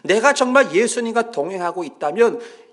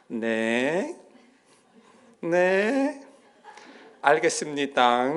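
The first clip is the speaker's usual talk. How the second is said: meek phone yes; 2 semitones lower, much slower than usual. Retold or imitated. Mother answers the pastor, suddenly meek. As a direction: answer politely like a good deacon. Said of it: "전화 오니까".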